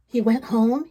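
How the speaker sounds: usual speaking voice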